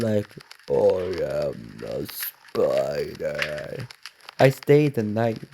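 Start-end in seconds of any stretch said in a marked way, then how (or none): 0.7-3.9 very low, very slow monster growl; creepy beast voice. Acted. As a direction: drag it out deep like a spider beast. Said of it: "talk like a creepy beast sound".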